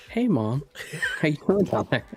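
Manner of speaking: ordinary delivery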